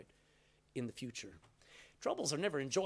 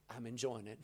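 laughing joy; 10 dB quieter than usual. Retold or imitated. Paul rejoicing.

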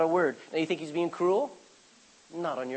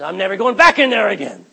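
indignant vow; 2 semitones higher, 12 dB louder than usual. Retold or imitated. Retold angry vow.

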